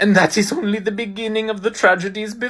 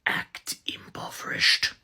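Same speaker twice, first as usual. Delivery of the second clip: whisper; 7 dB quieter than usual. Acted. Whispered aside to family.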